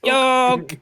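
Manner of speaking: loud call across the house. Pretend. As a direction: yell the name upward. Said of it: "I actually yell out Yook".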